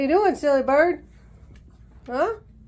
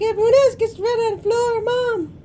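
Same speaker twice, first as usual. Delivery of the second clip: voicing the bird; 9 semitones higher than usual. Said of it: "She's like".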